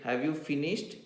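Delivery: "finished" lifted at the end as a question; questioning tune. Shown as a demonstration. Teacher checking on pupils copying notes, questioning tune. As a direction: let the tune rise on "finished". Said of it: "your tune should reflect what you meant".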